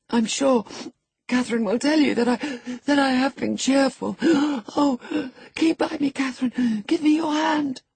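high, breathless, frightened pleading; panting. A narrator's reading. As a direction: gasp between phrases as if about to faint. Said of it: "he panted".

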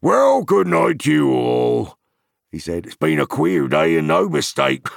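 loud, high rustic farmer voice; gruff old farmer. A narrator's reading. Farmer explaining to Merry before heading home, loud and rustic. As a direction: grumble kindly, eager to get home.

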